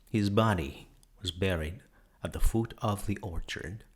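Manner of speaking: plain reading voice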